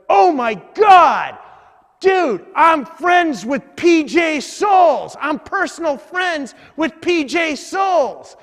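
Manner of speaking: high, loud, drawn-out excited shouting; imitating a fan's astonished holler; starstruck passerby. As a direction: yell like an overexcited fan. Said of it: "this dude comes walking up".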